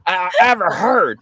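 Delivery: high, loud, thrilled praise; excited shout. Retold or imitated. Quoting the expert praising the Dog Man call, an excited shout. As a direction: shout it like you can't believe it. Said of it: "He was like".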